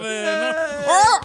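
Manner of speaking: ordinary delivery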